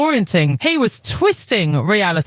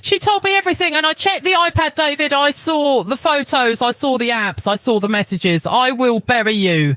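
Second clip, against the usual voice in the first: raised accusing wife; 8 semitones higher than usual. Acted. Wife confronting husband.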